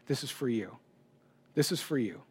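a gentle inner voice speaking to him; God's quiet voice. Retold or imitated. God speaking to him in the ceremony, soft and hushed. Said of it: "God is speaking to me very clearly".

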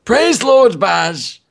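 put-on drawn-out, high old man voice; slow old man voice. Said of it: "give him an old man voice".

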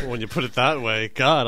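usual speaking voice

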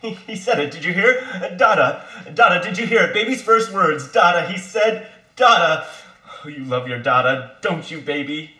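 put-on high, loud, gushing baby-proud chatter; thrilled father. Father tells mother the baby said Dada, thrilled. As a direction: gush over the first word like it's a miracle.

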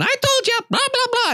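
high-pitched mock wife heckling from behind; nagging wife. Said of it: "my wife is in the background going".

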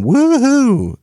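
high, loud party whoop; tipsy reveller cheering; drunk whoop. Retold or imitated. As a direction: let out a wild drunken whoop. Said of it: "you're like".

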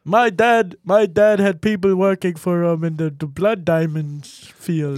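loud, strained mock accent; hammed-up impression, drawing words out; Elon Musk. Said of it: "I think it's like this".

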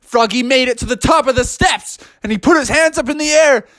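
high, rousing storytelling; thrilled high-pitched narrator; excited narration. A narrator's reading. Story climax on the steps.

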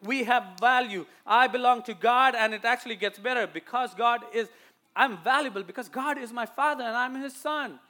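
high, loud, insistent sermon; forceful preaching. Real, not acted. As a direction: drive the point home to the church. Preaches to the congregation.

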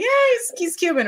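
mock offhand quote. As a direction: toss it off in a high little voice. Mimics people's careless assumption.

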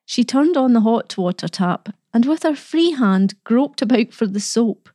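usual speaking voice

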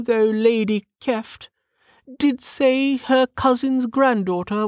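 high-pitched aristocratic lady; high, mannered noblewoman voice; chatty noblewoman. Acted. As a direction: play a talkative lady in a high voice. Narrator voices Lady Parr.

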